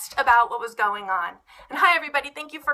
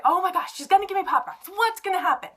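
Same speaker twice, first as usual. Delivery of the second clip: put-on excited kids; 7 semitones higher than usual. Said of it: "They could".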